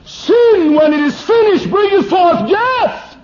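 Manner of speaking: high, loud, slow proclamation; thundering scripture. Real, not acted. Preacher proclaiming scripture about sin, thundering. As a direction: thunder the verse. Quoting scripture at full force.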